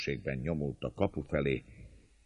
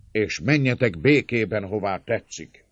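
raised voice easing down at the end; loud offer; 7 semitones higher, 8 dB louder than usual. A narrator's reading. Attacker offering servants free passage, calling out. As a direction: proclaim the terms loudly.

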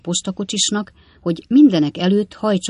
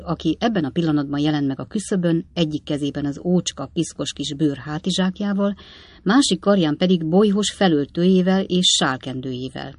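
ordinary delivery, nothing marked

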